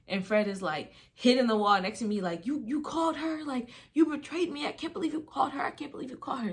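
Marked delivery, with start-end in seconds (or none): 2.5-6.5 freaking out. Retold or imitated. Boyfriend accuses girl of calling his mom, mimicked freak-out. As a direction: imitate someone spiralling over a betrayal, voice pitched high. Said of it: "Like, freaking out".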